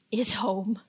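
dramatic whispered dread. A narrator's reading. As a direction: drop to a scared hush. Dread at mom's return.

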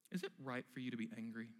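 soft reassuring teacher voice; patient parent. Acted. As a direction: ask it like a calm parent. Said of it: "like a good, patient parent".

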